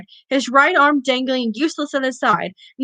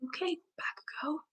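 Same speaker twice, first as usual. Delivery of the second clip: whisper; 5 semitones higher, 20 dB quieter than usual. Real, not acted.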